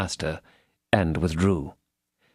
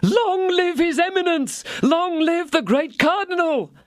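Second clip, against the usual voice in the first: very high, ecstatic mercer's cheer; crying out enthusiastically. A narrator's reading. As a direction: cheer at the top of your voice, far down the hall. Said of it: "crying out at the top of his voice".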